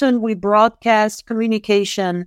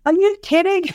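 higher-pitched mock-incredulous parent; amused disbelief; 7 semitones higher than usual. Parent hearing what the daughter remembers, mock-incredulous. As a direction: react in playful disbelief to a silly answer. Reacts to daughter's silly answer.

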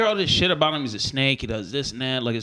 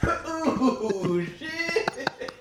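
laughing hype call; 10 semitones higher, 6 dB quieter than usual. Acted.

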